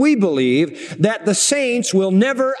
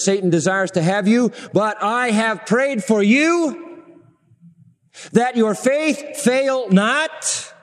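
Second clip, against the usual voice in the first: Jesus warning Peter slowly; 5 semitones higher, slower than usual. Retold or imitated. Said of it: "he says".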